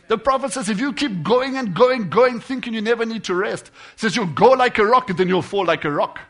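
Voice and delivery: loud, high-pitched preaching burst; full-force preaching. Real, not acted. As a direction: drive it home to the congregation. Preacher raises his voice.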